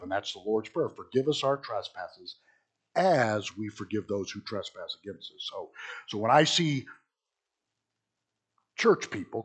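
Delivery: ordinary delivery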